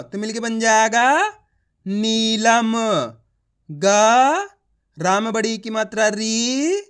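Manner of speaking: each syllable held long, then stretched whole words like नीलम and शीतल; teacher's drawn-out spelling chant; letter-by-letter demo. Shown as a demonstration. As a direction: sound out नी, ला, म slowly, then blend. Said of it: "एक अक्षर का उच्चारण कर रहा हूँ".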